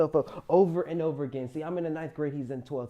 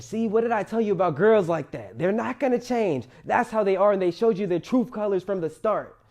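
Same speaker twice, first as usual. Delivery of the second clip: higher, louder scolding mom voice; told-you-so lecture; 7 semitones higher, 6 dB louder than usual. Retold or imitated. Mom to son about girls like that, insistent told-you-so scolding. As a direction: imitate a mother driving home her warning.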